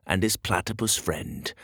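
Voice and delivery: ordinary delivery, nothing marked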